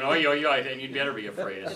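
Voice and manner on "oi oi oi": louder, squawked "Oi, oi, oi" calls; a lighter replay of the eagle's cry. Acted. Teacher warns what an eagle's cry would mean, a loud mock squawk. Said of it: "If you heard an eagle going".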